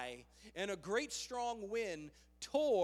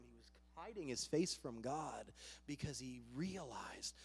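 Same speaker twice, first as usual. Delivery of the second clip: normal talking voice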